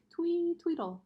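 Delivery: lightly chirped birdsong; a hint of a bird's twee tweedle; little bird call, barely sung; chickadee twee. A narrator's reading. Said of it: "their songs had sounded like".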